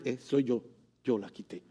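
soft, low, modest "yo la quité"; a hint of a shy angel confessing quietly; hushed angel. Angel admitting he moved the stone, soft modest confession. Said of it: "Dice un ángel".

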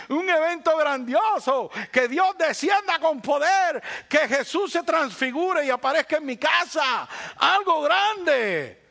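hammed-up proclamation of a big miracle; grand miracle shout. Pastor mocks people waiting for a grand event, shouting slowly.

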